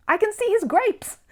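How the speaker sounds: very high, delighted little bear; high and pleased; high Paddington voice. A narrator's reading. Paddington spots the grapes.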